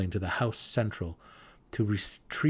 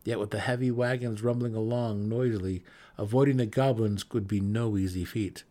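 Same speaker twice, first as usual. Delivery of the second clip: ordinary delivery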